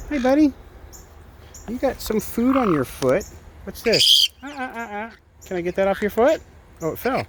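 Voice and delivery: high sing-song pet voice; high cooing voice for a pet. Real, not acted.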